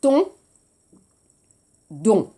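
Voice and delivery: "Ton" with crisp unvoiced T, "Don" with voiced D; T versus D. Shown as a demonstration. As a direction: contrast "Ton" and "Don" clearly. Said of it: "répétez".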